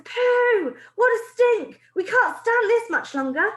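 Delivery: high, loud revolted crying out; disgusted witches' cries. Acted. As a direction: cry out at the stench. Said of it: "there were cries of".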